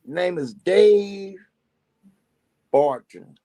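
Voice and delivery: each word slow and separate; slow and clear. Shown as a demonstration. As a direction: spell it out slowly. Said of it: "Hope you can see this".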